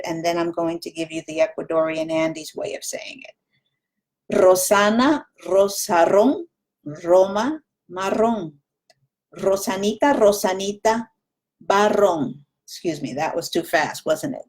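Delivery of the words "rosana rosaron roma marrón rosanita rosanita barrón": Rosana, Rosaron, Roma with a trilled Latin American R; rolled R, Latin American style. Shown as a demonstration. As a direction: roll every R in Rosana and Marrón crisply. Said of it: "the way most people in Latin America say it".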